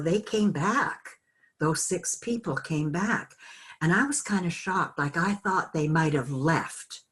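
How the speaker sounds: ordinary delivery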